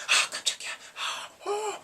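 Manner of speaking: gasping, drawn-out imitation of fright; hammed-up scared moviegoer; mock scared. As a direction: panic breathily, then yelp high. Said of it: "Guess who gets scared?".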